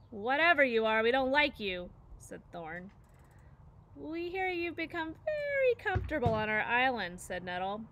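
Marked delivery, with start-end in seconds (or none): 0.1-1.9 louder hostile cub; threatening. A narrator's reading. Bear cub threatens robot. 3.9-7.2 higher, mock-friendly menace; sweet but dangerous; menacing bear. A narrator's reading. Bear threatens robot.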